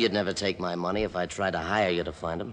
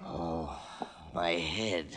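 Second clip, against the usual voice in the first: groaning in pain; 5 semitones lower, 8 dB quieter, much slower than usual. Acted. Just knocked out, coming to.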